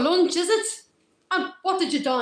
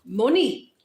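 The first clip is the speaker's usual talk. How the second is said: ordinary delivery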